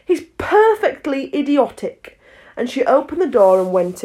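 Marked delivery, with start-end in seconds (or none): 0.1-2.2 exasperated. A narrator's reading.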